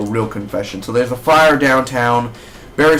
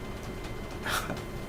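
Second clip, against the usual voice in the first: short high giggle; real soft laugh; 17 dB quieter than usual. Real, not acted. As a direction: giggle at yourself. Laughs at herself.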